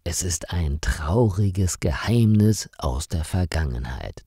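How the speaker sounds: usual speaking voice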